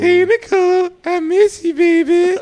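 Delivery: high, loud sweet-talking; flirty phone voice.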